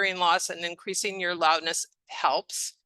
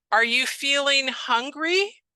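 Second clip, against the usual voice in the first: higher, slow question with a rising end; slow patient question; 6 semitones higher, slower than usual. Shown as a demonstration. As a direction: ask slowly so the patient can follow. Models simple question to a patient.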